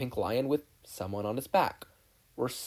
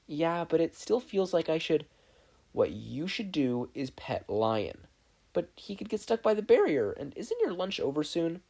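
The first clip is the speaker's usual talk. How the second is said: put-on higher, unsure boyish voice; worried objections; lightly voiced Steven; 6 semitones higher than usual. Steven protesting to Connie, unsure.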